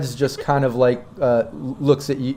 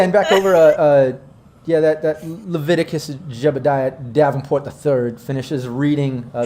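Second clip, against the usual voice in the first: ordinary talk, nothing marked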